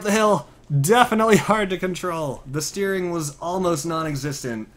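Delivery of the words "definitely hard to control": ordinary talk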